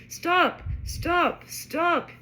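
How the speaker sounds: high, loud repeated command; three sharp high yells. A narrator's reading. Ron shouts at the plummeting car, yelling. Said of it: "he yelled".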